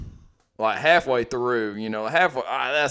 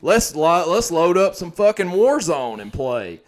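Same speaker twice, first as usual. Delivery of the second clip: quoting a commenter; 7 semitones higher, 8 dB louder than usual. Retold or imitated. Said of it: "they was like".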